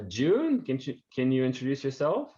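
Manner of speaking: ordinary talk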